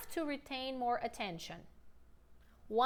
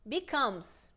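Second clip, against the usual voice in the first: ordinary delivery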